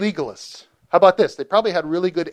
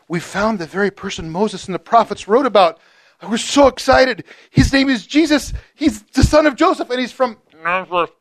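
higher and louder, bursting with excitement; can't hold it in; excited news; 6 semitones higher, 4 dB louder than usual. Retold or imitated. Philip tells his brother excitedly.

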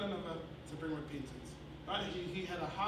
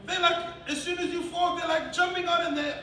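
raised, loud sermon delivery; forceful preaching; 8 semitones higher, 7 dB louder than usual. Real, not acted. Preacher denouncing media's delight in evil, forceful preaching. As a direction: drive it home to the congregation.

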